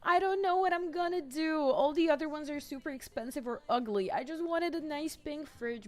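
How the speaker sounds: high-pitched wail of disappointment; upset, really sad. Real, not acted. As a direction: lament it high, like a let-down whine. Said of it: "I'm really sad".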